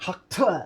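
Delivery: throaty hawk and spit noise; mimed spitting. Retold or imitated. Host acting out spitting it out, mimed spit noise. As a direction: imitate hawking up and spitting, softly. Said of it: "I would have went".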